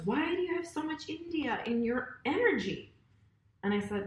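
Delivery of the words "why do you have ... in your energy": higher-pitched imitation of his puzzlement; baffled question. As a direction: ask it mock-baffled, pitched up. Retold man's baffled question.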